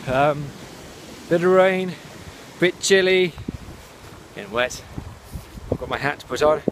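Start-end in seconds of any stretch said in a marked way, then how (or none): 0.1-3.3 shouting over wind. Real, not acted. Windy allotment, talking over gusts.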